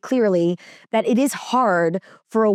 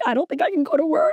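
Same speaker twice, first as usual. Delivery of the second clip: high, rushed retold sob-talk; mock hysterical; 6 semitones higher, faster than usual. New mom tells her nanny she can't leave baby, mock hysterical. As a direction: imitate tearful panic, words tumbling out high. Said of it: "I was hysterical".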